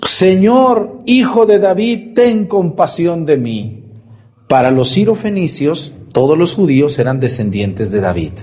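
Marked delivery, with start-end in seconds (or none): 0.0-3.8 higher, begging call to Jesus; mother pleading with Jesus for mercy; pleading cry. Retold or imitated. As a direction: cry out for mercy, reaching high. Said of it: "y grita".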